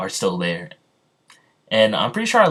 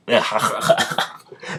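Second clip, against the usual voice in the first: breathy burst of real laughter. Real, not acted. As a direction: crack up at your own slip. Cracks up at own mispronunciation.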